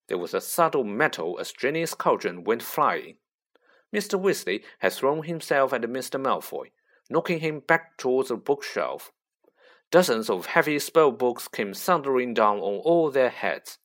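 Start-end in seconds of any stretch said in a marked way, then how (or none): none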